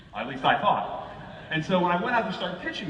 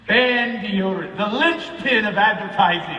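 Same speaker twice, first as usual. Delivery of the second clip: mock grand announcement; 6 semitones higher, 7 dB louder, slower than usual.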